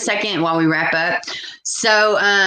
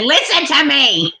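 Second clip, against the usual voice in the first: high, insistent quoted voice, pitched up; mock bossy commenter; 11 semitones higher than usual. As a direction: imitate her telling Linda to listen. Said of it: "she said".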